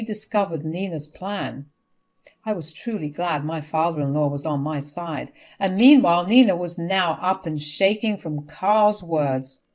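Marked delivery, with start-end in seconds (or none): none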